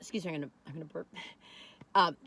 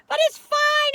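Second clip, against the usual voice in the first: sing-song dismissal; 10 dB louder, slower than usual. Real, not acted. Shrugging off mediocre fish.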